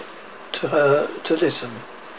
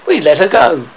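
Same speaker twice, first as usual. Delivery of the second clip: louder, complacent statement; 3 semitones higher, 6 dB louder than usual. A narrator's reading. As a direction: report it as if all is well. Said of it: "Smee answered complacently".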